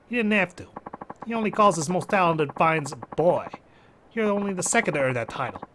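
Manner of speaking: put-on Jersey accent. Mafia recruiter explaining the title to a fighter, Jersey accent. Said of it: "Japanese mafia member has a Jersey accent".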